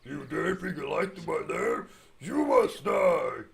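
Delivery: high, loud, slow villain shout; dark lord. Pretend. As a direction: roar out a villain's threat. Voicing the boss in-game.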